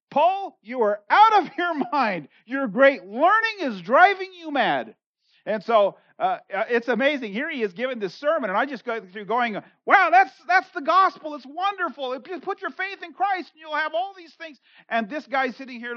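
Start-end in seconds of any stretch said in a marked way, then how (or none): 0.1-5.0 loud, slow accusing cry. A narrator's reading. Festus shouting at Paul before the court, loud and slow. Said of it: "Festus said in a loud voice". 9.9-14.6 excited preaching. Retold or imitated. Imitating Paul preaching excitedly.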